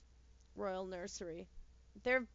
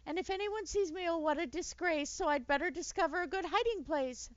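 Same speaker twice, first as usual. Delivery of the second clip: high, loud despairing lion rant; a vain lion fretting about disgrace; panicked lion; 7 semitones higher, 5 dB louder than usual. A narrator's reading. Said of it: "he shouted".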